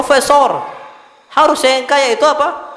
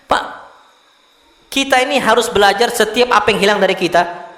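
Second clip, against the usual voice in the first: ordinary delivery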